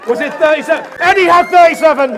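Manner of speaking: very loud, high shout; calling out. Real, not acted. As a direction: holler it across a noisy room. Calls out across the wedding room.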